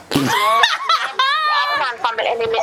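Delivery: high, fast laughing line. Real, not acted. Friend laughs off the accusation, high and fast. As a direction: laugh it out in disbelief.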